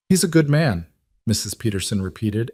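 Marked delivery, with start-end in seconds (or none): 0.1-0.9 "He's a good man" pitched up high for emphasis; emphatic. A narrator's reading. Mrs. Peterson vouches again for the rancher, emphatic "He's a good man", pitched high. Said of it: "as if this needed emphasis".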